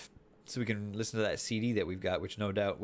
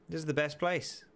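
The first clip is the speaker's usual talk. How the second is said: higher, more thrilled second take; excited; 6 semitones higher than usual. Real, not acted. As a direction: say it again with real delight.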